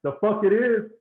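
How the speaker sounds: angry comeback. Retold or imitated. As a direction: fire back at the shortstop, hammed-up rage. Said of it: "it just escalated like that instantly".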